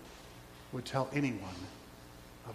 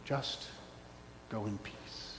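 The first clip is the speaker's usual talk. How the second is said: tender blessing; 7 semitones lower, 13 dB quieter than usual. Acted.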